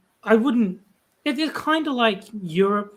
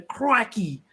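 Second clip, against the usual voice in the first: mock Australian "crikey"; 3 semitones higher than usual. Shown as a demonstration. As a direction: put on a broad Aussie accent. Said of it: "stereotypical Aussie accent".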